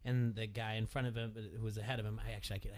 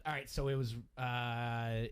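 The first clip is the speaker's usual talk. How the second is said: ordinary delivery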